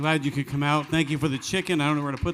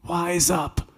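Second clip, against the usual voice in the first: lightly voiced kid's yell, a bit higher; mimicking a kid; 6 semitones higher than usual. Uncle teasing his nephew, lightly mimicking the child's yell. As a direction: tease by echoing the boy's cry. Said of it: "to mimic me".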